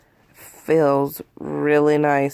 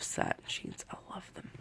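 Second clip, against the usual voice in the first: breathy little whisper; whispered aside; 15 dB quieter than usual. Real, not acted. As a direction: murmur it to yourself. Whispered aside about loved sheets.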